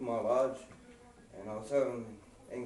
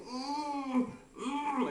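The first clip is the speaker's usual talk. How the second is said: whimpering; 12 semitones higher than usual. Retold or imitated. Buddy heard crying next door.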